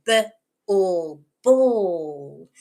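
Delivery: "b" as buh, long "all", then joined "ball"; emphasized first sound. Shown as a demonstration. Teacher emphasizes first sound, "b", "all", then whole "ball". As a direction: separate "b" from "all", then blend into drawn-out "ball". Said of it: "really emphasizing that first sound".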